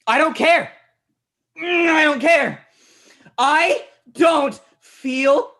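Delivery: high, loud outburst of exasperation; enraged cries, word by word at the end; furious shouting. Acted. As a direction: yell them down at full volume. Snaps at companion's correction.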